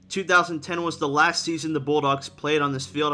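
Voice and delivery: ordinary talk, nothing marked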